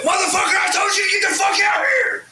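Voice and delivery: screaming at the top of his voice, very high; furious shout. Retold or imitated. Rapper yelling at a late bandmate, full furious shout. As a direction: blow up and scream it. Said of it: "I went in".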